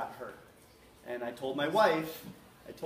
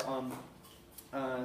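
normal talking voice

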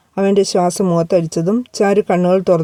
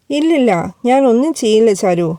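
ordinary delivery, nothing marked

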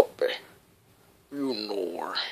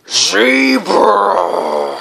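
excited cry; 7 semitones higher, 6 dB louder than usual. Acted. Cried out excitedly.

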